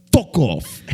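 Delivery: imitating the girl poking his glasses; British accent. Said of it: "pull out your British accent again".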